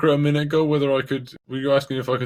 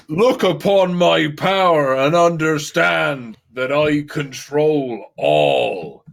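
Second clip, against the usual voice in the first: slow grand god voice; 3 semitones higher, 5 dB louder, slower than usual. Acted. Player as god shows power.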